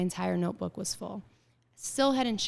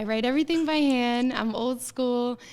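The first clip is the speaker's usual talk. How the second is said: proud declaration; 5 semitones higher, 7 dB louder than usual. Real, not acted. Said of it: "I'm my grandmother's granddaughter".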